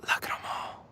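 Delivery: whispering. Real, not acted. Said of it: "[name], why stop?".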